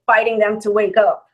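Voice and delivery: normal talking voice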